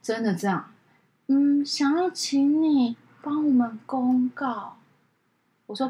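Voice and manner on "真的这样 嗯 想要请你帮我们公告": slow, quiet dithering caller; slow caller mimic. Assistant imitates caller finally asking, slow and vague. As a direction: drag it out, mumbling.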